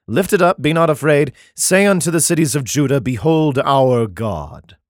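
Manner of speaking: solemn, measured Bible reading; declaiming scripture, slowing at the end. Acted. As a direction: read it like a King James passage. Reads Isaiah aloud.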